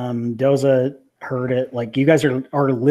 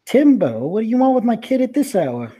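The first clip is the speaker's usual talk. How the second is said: annoyed mother voice; 10 semitones higher, 3 dB louder than usual. Acted.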